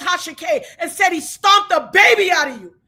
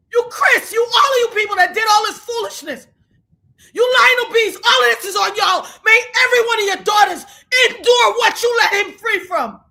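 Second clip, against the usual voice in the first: higher and louder, shouting curses; shouting angry curses; furious shouting; 5 semitones higher, 5 dB louder than usual. Real, not acted. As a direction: hurl it at them. Cursing the people he blames.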